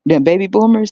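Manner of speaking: ordinary talk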